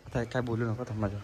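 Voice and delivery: low, soft hiding voice; quiet murmur so they aren't heard; hushed low voice. Real, not acted. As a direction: keep it down while hiding. Hiding, telling kids quiet.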